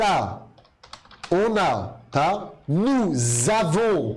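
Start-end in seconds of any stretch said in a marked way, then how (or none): 2.7-4.1 "Nous avons" slow, linking nouz-avons with a clear z; liaison with z sound. Shown as a demonstration. Teacher drilling liaison for students, slow and clear. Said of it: "com som de Z".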